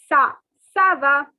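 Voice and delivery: ça said sa, not ka; French ça. Shown as a demonstration. Teacher correcting kava, careful French. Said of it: "it is".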